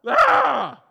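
loud high exasperated yell; frustrated scream. Retold or imitated. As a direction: let out a cry of pure frustration. Frustrated yell after the outburst.

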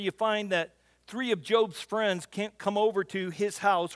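ordinary talk, nothing marked

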